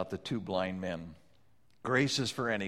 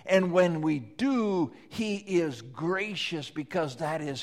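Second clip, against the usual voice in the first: high, loud preaching voice; full-force preaching; 7 semitones higher, 6 dB louder than usual. Acted. Preacher exhorting the congregation, full-force preaching. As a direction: proclaim grace to the congregation.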